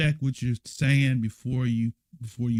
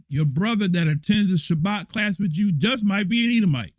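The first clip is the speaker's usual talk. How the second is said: teasing jab; 8 semitones higher, 5 dB louder than usual. Real, not acted. Teasing the class member's brother.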